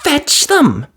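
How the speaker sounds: high, sharp command; fiery fairy order. A narrator's reading. Fairy ordering Cinderella to fetch the mice, sharp. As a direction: send her running.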